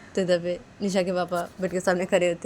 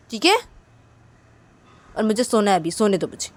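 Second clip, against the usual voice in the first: louder, higher snappish sulk; sulky refusal; 6 semitones higher, 11 dB louder than usual. Acted. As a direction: shut her out, irritated. Nisha refuses to talk.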